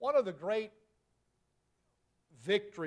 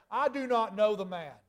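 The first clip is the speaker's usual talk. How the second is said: higher, louder cursing denial; a forceful, heated I do not know the man; 4 semitones higher, 6 dB louder than usual. Acted. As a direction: swear it off, angry and cornered. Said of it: "he began to curse and to swear".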